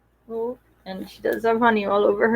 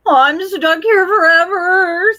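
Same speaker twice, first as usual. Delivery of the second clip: despairing cow; 7 semitones higher, 6 dB louder than usual. Acted.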